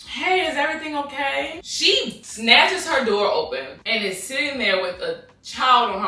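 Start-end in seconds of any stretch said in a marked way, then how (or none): 0.0-1.6 polite high check-in. Retold or imitated. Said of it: "I'm like".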